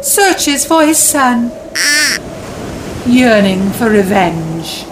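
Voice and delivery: dramatic story narration. A narrator's reading. Dramatic narration of Hamlet scene.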